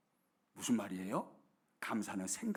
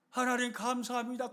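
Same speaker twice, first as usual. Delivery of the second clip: chick voice; 8 semitones higher than usual. Pretend. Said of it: "하늘 쳐다보고".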